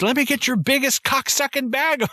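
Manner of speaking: prim lady saying a filthy order; host mocking Annie buying chicken feed, squeaky prim voice. Mocking Annie's swearing at store.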